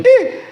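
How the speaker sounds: high short "ih" squeal; sudden high-pitched baby yelp; imitated baby squeal. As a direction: squeal like a delighted baby copycat. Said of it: "daqui a pouco o garoto".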